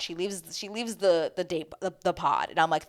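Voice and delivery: ordinary talk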